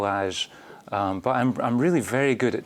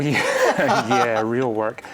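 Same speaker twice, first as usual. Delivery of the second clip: laughing; 6 dB louder, much slower than usual. Real, not acted. Laughs at own joke.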